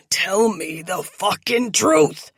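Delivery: loud furious villain yell. Acted. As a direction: scream the demand. Captor yelling demands.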